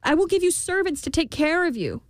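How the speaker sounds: very high, pleading queen; desperate bargaining. A narrator's reading. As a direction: make an urgent offer to the imp. Queen bargains desperately for her baby.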